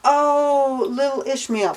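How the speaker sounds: lightly voiced God cooing to a child; mock God. God calling to little Ishmael, lightly mocked. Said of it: "comes and says".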